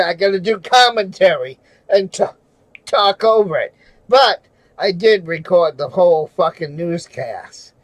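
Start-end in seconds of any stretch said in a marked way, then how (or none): none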